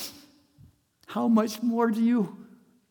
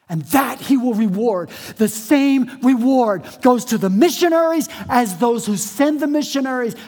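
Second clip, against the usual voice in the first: louder emphatic pulpit voice; preaching; 5 semitones higher, 9 dB louder than usual. Real, not acted. Pastor proclaiming the same reward for all, forceful preaching. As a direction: hammer the point home.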